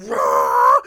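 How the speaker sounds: loud, low growling roar; hammed-up furious growl; mock rage roar. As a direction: roar like an enraged driver. Imitating own road rage.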